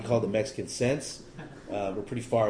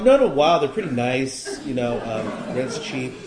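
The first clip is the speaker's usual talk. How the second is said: laughing cover story; 2 semitones higher, 7 dB louder, slower than usual. Retold or imitated. Covering the truth to a bar stranger, laughing. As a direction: brush it off with a laugh.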